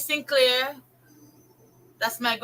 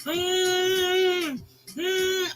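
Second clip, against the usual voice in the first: imitated humming; 6 semitones higher than usual. Mother recalling the child she teased, imitated humming. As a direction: mimic the child's droning hum.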